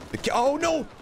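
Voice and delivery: ordinary talk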